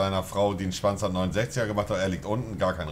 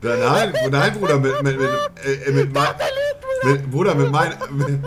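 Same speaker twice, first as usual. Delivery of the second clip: loud, higher, halting words broken by laughter; laughing and stammering; 7 semitones higher, 10 dB louder than usual. Real, not acted. Laughing as he protests to his buddy that he missed. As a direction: stumble over the words while laughing.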